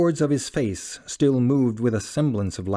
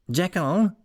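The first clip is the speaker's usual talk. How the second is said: higher call of the name across the door; loud cry; 8 semitones higher than usual. A narrator's reading. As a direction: call through the locked door to be heard. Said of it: "cried Utterson with a loud voice".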